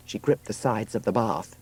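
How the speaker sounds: plain reading voice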